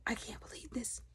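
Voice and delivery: whispered disbelief; character stunned, whispering. Retold or imitated. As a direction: breathe it out in a whisper. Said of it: "she was like".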